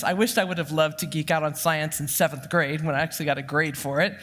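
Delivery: usual speaking voice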